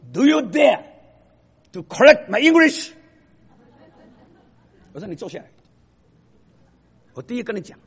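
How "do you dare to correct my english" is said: loud, high, indignant retort; shouted outrage from a student corrected on his English; angry student. Retold or imitated. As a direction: hurl it back at the professor. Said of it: "这个人很不高兴".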